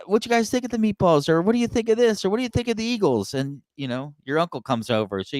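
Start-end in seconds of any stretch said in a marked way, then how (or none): 0.1-3.3 high-pitched imitation of a fussing aunt; Italian aunt. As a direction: play the aunt pressing food on you. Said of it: "she says".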